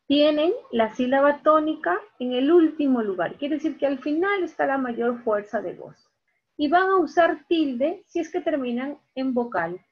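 ordinary delivery

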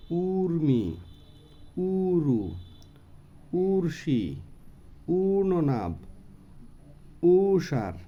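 first vowel of উর্মি, উরু, উর্শি drawn out; long opening vowel. Shown as a demonstration. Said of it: "একটু লম্বা উ". (উর্মি, উরু, ঊষার: শুরুর উ লম্বা টেনে)

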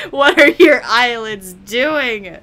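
loud, drawn-out disbelief; mock alarm. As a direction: cry it out slowly, appalled in jest.

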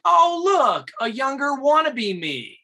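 high, loud sneering mimic; mock his cocky jab; mocking actor. Actor jabbing at the younger lookalike, high sneer. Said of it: "He's like".

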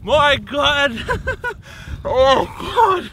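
breathless laughing from the cold; shocked laughing. Real, not acted. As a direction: laugh through the icy shock. Just soaked in ice water.

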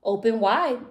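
dentist's instruction. A narrator's reading. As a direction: call it out as a dinosaur dentist. Dentist voiced in the story.

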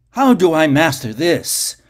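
loud, pleading cry; a desperate yell from under the soil; frantic shout. Acted. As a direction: demand the answer, voice breaking. Garak shouts in nightmare.

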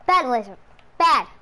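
loud, short reprimand; mock-stern, punched out; pretend scolding. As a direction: tell off a naughty pet.

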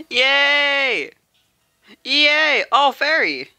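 high, loud squeal of joy; excited cheer. Real, not acted. Gamer cheering as the card finally drops. Celebrating the pull.

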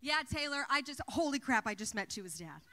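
loud, overjoyed phone report; thrilled shout. Retold or imitated. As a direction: burst out the news to your friend. Breathless phone call after meeting.

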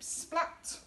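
plain reading voice

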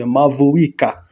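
ordinary talk, nothing marked

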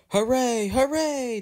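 very high, whistle-like hooray; whistled cheer. A narrator's reading. Engines cheering the trip to England, whistling. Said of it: "the engines whistled".

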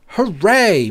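high-pitched storybook cheer; a small, high hooray. A narrator's reading. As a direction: cheer it lightly, pitch lifted. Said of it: "Molly cheered".

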